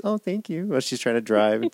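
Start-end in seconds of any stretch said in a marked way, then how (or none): 0.0-0.7 soft, dainty thank-you; small sweet voice; cute little voice. Retold or imitated. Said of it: "And just cute little voice".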